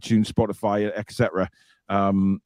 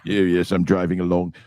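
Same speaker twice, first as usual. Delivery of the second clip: mocking James May accent; 8 semitones lower than usual. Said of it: "takes the piss out of his accent".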